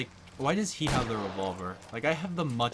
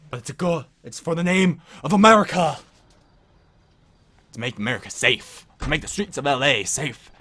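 loud, hammed-up patriotic cop; 1930s beat cop; 7 dB louder than usual. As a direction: preach it like a proud lawman. Mock patriotic cop speech.

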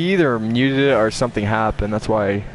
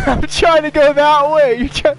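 high, loud, laughing; laughing frustration; 5 dB louder than usual. Real, not acted. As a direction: push it out high through laughter. Laughing while struggling in game.

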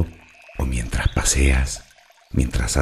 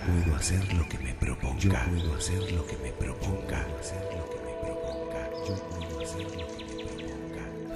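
much softer echo, receding into silence; fading echo; 4 semitones higher, 11 dB quieter than usual. Acted. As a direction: repeat the affirmation like a distant, fading inner voice. Affirmation repeated as fading echo. (eco suave que se desvanece)

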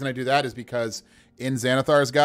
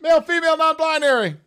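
very high, loud, squealing disbelief; incredulous excitement; 6 dB louder than usual. Real, not acted. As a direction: exclaim it, overcome by the dice. Amazed by repeated roll result.

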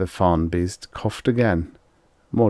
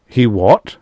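louder sharp question; demanding; 4 semitones higher, 9 dB louder than usual. Acted. Extroni demands Rye finish, a sharp question. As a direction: press for the answer. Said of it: "Extroni demanded".

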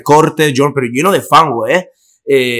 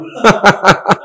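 high laugh; 7 semitones higher than usual. Real, not acted. After telling the teacher it was him, a high laugh.

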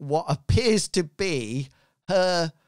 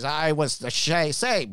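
pirate voice; 3 dB louder than usual. Acted. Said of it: "my pirate voice".

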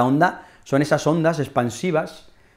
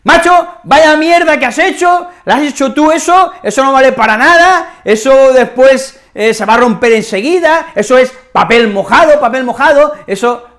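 much higher, louder, scolding rant; angry rant; 8 semitones higher, 7 dB louder than usual. Acted. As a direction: tear into someone over shoddy work. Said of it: "alguien viene y nos empieza a echar un puro". (alguien echando la bronca, agudo y a gritos)

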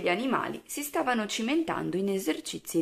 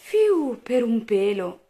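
loud "phew", starting high; big relieved exclamation with a smile; smiling relief; 4 semitones higher, 9 dB louder, slower than usual. A narrator's reading. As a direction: blow off the tension after a near miss. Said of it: "disse Oscar sorridendo".

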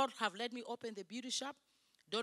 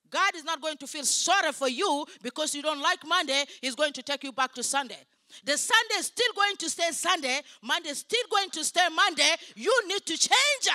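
loud, high preaching, hammering each phrase; raised-voice sermon scolding; forceful rebuke; 4 semitones higher, 9 dB louder than usual. Real, not acted. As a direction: drive it home to the congregation, building.

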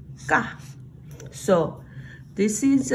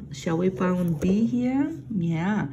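usual speaking voice

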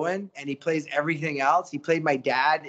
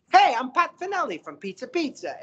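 high, bright-sounding pitchman imitation of a radio ad guy; radio spokesman voice; 7 semitones higher than usual. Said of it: "And he's like".

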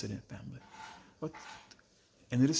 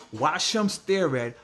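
ordinary talk, nothing marked

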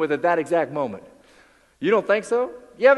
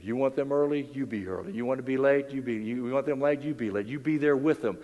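ordinary talk, nothing marked

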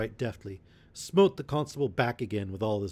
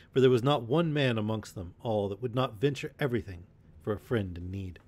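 ordinary delivery

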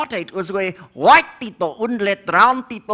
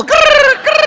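high, loud trilled cricket chirp; 9 dB louder than usual. Retold or imitated. Comic showing the insect's noise, high trilled chirp. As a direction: imitate a cricket, piercing and rolled. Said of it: "That is the sound".

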